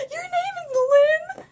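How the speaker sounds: very high, slow squeal of mock laughter. Kid ridicules her name to her face, mocking laughter. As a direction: cackle through it, high and drawn out.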